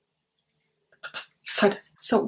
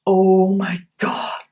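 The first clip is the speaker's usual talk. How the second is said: drawn out; much slower than usual. Pretend. Awed at the palette.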